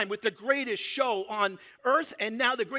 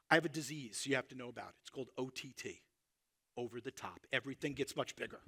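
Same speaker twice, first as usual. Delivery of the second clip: hushed confession; 8 semitones lower, 11 dB quieter than usual. Acted. Confiding a secret in a hush.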